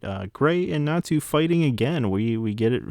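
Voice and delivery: ordinary talk, nothing marked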